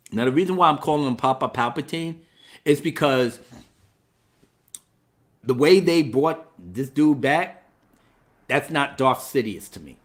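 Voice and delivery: ordinary delivery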